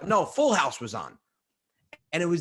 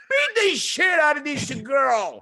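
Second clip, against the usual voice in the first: high, slow, louder rant; father fuming at the TV, slow and loud; ranting dad; 9 semitones higher, 4 dB louder, slower than usual. Retold or imitated. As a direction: hammer each word out in outrage.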